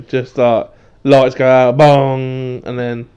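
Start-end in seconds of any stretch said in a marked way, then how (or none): 1.0-2.6 dramatic entrance. Pretend. Acting out Undertaker entrance.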